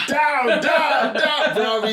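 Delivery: loud, high imitated order; shouted command. Security guy ordering the cheater down, shouted command. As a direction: call it out like a guard taking someone down. Acting guard ordering man down before tasing.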